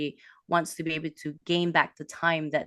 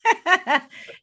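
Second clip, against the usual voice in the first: high, bright burst of laughter; 8 dB louder than usual. Real, not acted. Laughing at how cringy his own recordings are, high laugh.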